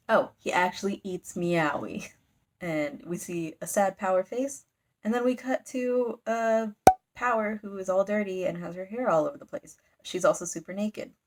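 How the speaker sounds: ordinary delivery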